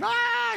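high yelped ah; a short imitated cry far out at sea; mock scream. Acted scream in the ocean.

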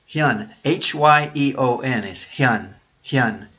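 Hyun spelled out letter by letter, H Y E O N, then said as one clipped syllable; slow letter-by-letter spelling of Hyun; careful spelling. Shown as a demonstration. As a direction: spell Hyun slowly and clearly. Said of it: "Let me have it spelled correctly now".

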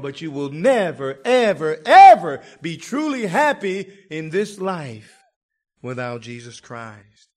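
"never, ever, ever" rising to a loud high preached cry, then dropping at "without Jesus Christ". Shown as a demonstration. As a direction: hammer "never" and "ever" harder each time. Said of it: "Did you hear that?".

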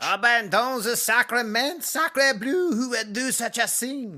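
exaggerated French accent; french chef. [name]'s French exclamation.

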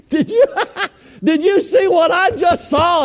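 high, excited yelp through laughter; astonished. Retold or imitated. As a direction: act out laughing, giddy amazement, voice way up. Pastor reacts after truck passes.

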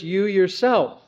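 slow, weighted "you yourself", leaning on "yourself"; deliberate stress on "yourself". Shown as a demonstration. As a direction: dwell on "yourself" to make it land. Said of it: "there is an emphasis here in the words".

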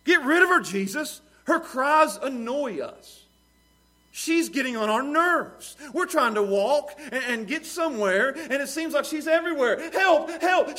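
high, loud, exasperated complaining; annoyed disciples. Retold or imitated. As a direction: whine it out like fed-up followers. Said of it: "what do they say?".

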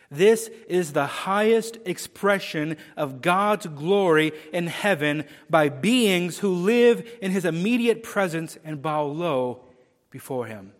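ordinary delivery

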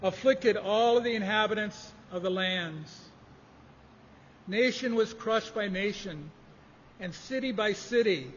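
scripture reading. Acted. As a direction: read it out like holy writ to the church. Reads scripture aloud to congregation.

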